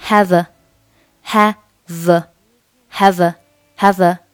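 "have a" joined as "ha-vuh"; linked have a. Shown as a demonstration. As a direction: carry the v of "have" into "a". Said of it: "这里的have和后面的a构成".

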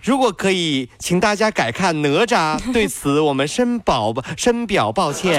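模仿机场播音员一板一眼地致歉; 音调偏高的公式化广播通知腔; 机场延误广播. Acted. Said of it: "就是在高铁，就是在机场". (学高铁、机场广播播报延误)

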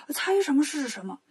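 ordinary delivery

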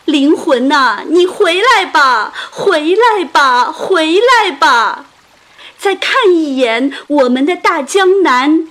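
high, loud pleading lament; sorrowful calling out. A narrator's reading. Mourners beg the soul to return, sorrowful calling. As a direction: call out across the water, drawing out the pleas.

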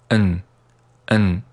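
"and" reduced to a bare "n"; weak form. Shown as a demonstration. Teacher showing learners weak "and", said as "n". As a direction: weaken "and" to just "n". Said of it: "and弱读成n".

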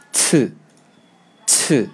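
刺 said with a strong puff of breath after the ch; aspirated ch sound. Shown as a demonstration. Teacher models the new letter's sound for students, strongly aspirated. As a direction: breathe out hard on 刺, like Korean ㅊ. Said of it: "这个音呢我们读".

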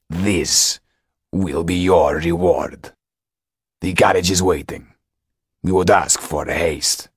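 slow, weighty servant of a count; imposing steward. A narrator's reading. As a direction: play the formidable steward, measured and firm. Steward delivers the count's offer.

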